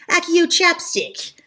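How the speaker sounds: high, slow incantation; mock spellcasting. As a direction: summon it with a pretend spell. Demonstrating a summoning spell.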